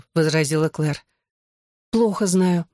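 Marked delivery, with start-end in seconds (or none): none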